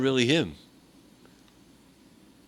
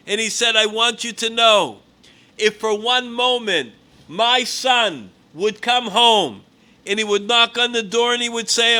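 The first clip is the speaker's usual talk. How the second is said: loud, raised preaching voice, pitched higher; preaching at full force; 5 semitones higher, 7 dB louder than usual. Acted. Preacher voicing a father's plea to the congregation, fervent and loud.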